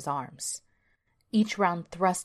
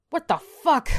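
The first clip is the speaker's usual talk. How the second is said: high, loud shocked exclamation; startled outburst; 10 semitones higher, 7 dB louder than usual. Acted. Alan jolted from trance-like sleep, startled shout. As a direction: jolt awake shouting.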